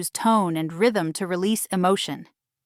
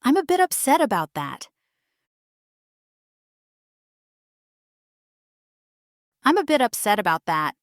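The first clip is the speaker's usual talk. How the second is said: softened, quick and mildly upset; polite anger; faster than usual. Shown as a demonstration. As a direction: sound upset without being rude. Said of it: "Now soften it for polite anger".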